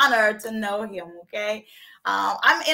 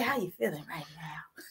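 whispered, much softer and lower; whispered question; 7 semitones lower, 11 dB quieter than usual. Real, not acted. Host whispering a personal question to her guest. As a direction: lean in and whisper it.

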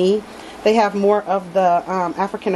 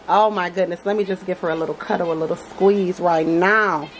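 usual speaking voice

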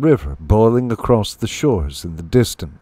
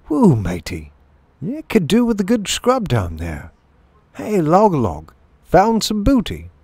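higher, chatty matey voice; lightly voiced galley mate; 9 semitones higher than usual. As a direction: call up to your chief, pitched high.

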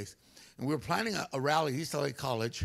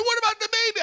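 high, louder anxious wife voice; worried wife; 5 dB louder, faster than usual. Retold or imitated. Preacher plays wife worried about the baby, high and loud. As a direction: squeal it like a panicked mother.

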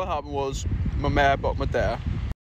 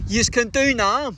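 higher, slow sing-song taunt; mock taunt; 6 semitones higher, 3 dB louder than usual. Real, not acted. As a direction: tease them, stretching it out. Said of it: "taunting deporters".

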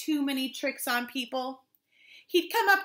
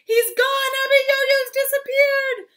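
high, loud jubilant cry; excited shout; 12 semitones higher, 8 dB louder than usual. Acted. People at their windows, excited shout. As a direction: shout the good news. Villagers rejoice giant gone.

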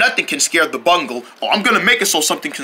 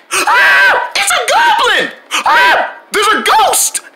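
high, loud frightened screams; terrified screaming; 4 dB louder, slower than usual. Acted. Flashback, getting scared.

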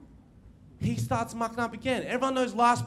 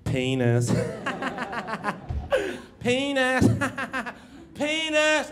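each penis higher and louder than the one before; 4 semitones higher, slower than usual. Retold or imitated. Kid at the back of class, escalating penis chant. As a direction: build it up like a kid's dare game. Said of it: "louder and louder".

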